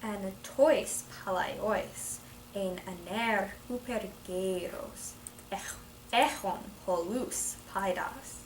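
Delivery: slow Greek read with each accent stressed; deliberate classroom reading of ancient Greek; careful stressed reading. Shown as a demonstration. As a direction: read the Greek slowly, leaning on accented syllables. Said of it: "working at stressing the right syllables".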